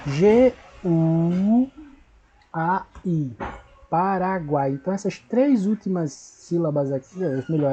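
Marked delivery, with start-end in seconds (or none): 0.1-4.7 G U, A I spelled slowly with pauses, then Paraguai; letter by letter. Shown as a demonstration. Teacher spelling Paraguai for pupils. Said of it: "na hora eu coloco para".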